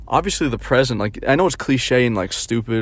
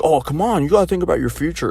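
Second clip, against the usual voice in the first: higher, fast pushy mock; mocking friend; 6 semitones higher than usual. Friend pushing him to plan, fast mocking higher voice. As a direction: rattle it off like a nagging buddy. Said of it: "my friend's like".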